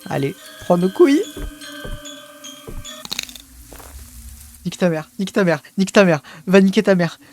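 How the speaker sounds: panicked yelling swears; loud frightened cursing; scared horror game streamer. Real, not acted.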